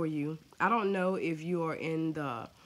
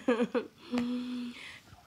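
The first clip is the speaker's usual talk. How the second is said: laughing; 8 semitones higher than usual. Real, not acted. Laughing after calling.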